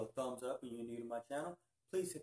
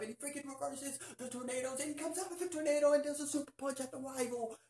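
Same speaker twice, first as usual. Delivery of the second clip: building up higher and louder to the punch; excited; 14 semitones higher, 3 dB louder than usual. Real, not acted. Fan telling viewers the big finale, rising excited. Climax of episode retold.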